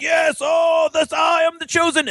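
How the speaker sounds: high shouted ecstatic cry; possessed preacher. Retold or imitated. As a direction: cry out like God just filled you. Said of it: "they're like".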